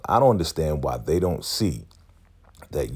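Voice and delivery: ordinary talk, nothing marked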